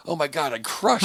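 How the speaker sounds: high-pitched mock boasting; mock elated comic. Comic gushing to himself after a set, mock elation. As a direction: imitate a comic thrilled with the set. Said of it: "Then you're like".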